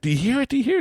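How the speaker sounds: high, eager showman's tease; building excitement. Retold or imitated. Dad teases son with jingling coins, high eager excitement. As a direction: retell it as high-pitched rising questions.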